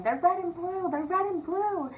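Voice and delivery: high mock scream at normal volume; high, drawn-out cry of alarm, held back; retold panicked scream. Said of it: "I remember screaming".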